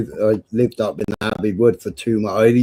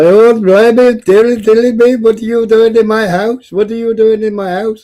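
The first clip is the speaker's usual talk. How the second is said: mock Indian woman voice; 11 semitones higher, 6 dB louder than usual. Stranger's wife finding a man in her house, high mock accent. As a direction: squeal it like a startled homeowner. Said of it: "And she's like".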